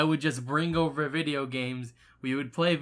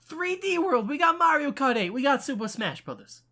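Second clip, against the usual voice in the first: high-pitched, louder excited listing, easing down at the end; excited hype; 10 semitones higher, 3 dB louder than usual. Real, not acted. Listing games to persuade a reluctant friend, excited. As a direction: hype up each title, pitched well up.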